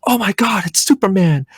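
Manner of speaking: high, excited gasp of wonder; star-struck child seeing a hero; amazed kid. Retold or imitated. Dreamer spots Superman overhead, high amazed cry. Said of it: "And I'm like".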